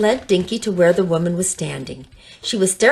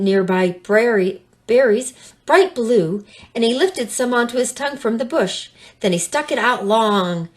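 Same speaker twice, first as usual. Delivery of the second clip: usual speaking voice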